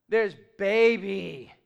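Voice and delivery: put-on "baby" drawn out slowly and loud; slow drawn "There's baby." in the demo; slow stretched baby. Shown as a demonstration. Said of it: "they all mean something different".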